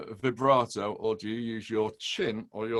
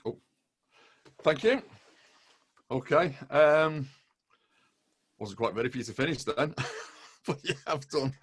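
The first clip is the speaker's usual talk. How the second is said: ordinary talk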